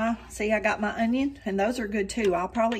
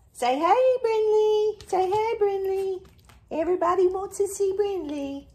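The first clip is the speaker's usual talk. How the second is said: much higher, louder pet voice; talking to the dog; 11 semitones higher, 4 dB louder than usual. Real, not acted. Owner greets her dog Brinley on camera in high pet voice.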